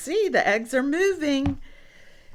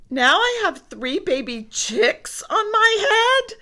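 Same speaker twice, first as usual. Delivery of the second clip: higher, louder disbelieving question; dismayed question; 11 semitones higher, 5 dB louder than usual. Acted. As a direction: Gerald in disbelief. Gerald dismayed.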